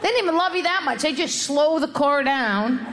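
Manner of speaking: usual speaking voice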